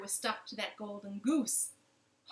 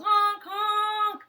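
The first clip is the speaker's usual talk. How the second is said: high, loud sing-song honking; goose honk; 10 semitones higher, 12 dB louder than usual. Acted. Goose honks at the reaching baker, high loud honk. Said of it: "And the goose yelled".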